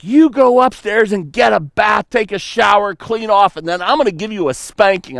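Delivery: high, stern scolding mother; child's mud on the floor, mom scolds angrily; mock angry mom. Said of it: "And she's like".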